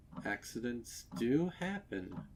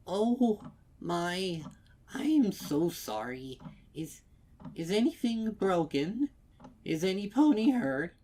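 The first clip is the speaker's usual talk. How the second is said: high, loud and worried; fretting after knocking the cake; flustered old mare; 10 semitones higher, 7 dB louder than usual. A narrator's reading. Old mare apologizing, flustered and high.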